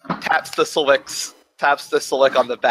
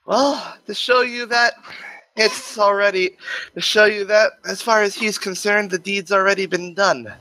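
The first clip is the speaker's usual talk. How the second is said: higher, measured voice of Death; Death's voice; 6 semitones higher than usual. Acted. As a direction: speak as a calm, amused Death.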